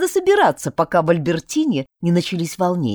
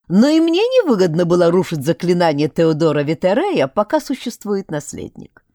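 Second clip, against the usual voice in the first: ordinary delivery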